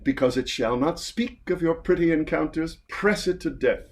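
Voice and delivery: theatrical verse delivery, lifting and swelling on key words; grand stage performance of old comic verse; dramatic play reading. Acted. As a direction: declaim it with relish, slowing into the flourish.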